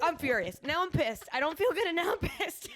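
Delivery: put-on high, loud, pouty baby voice breaking into laughs; mock-furious baby talk; whiny baby-talk complaint. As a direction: whine it like a cranky toddler. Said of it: "And I was like".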